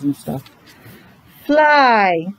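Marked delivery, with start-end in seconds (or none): none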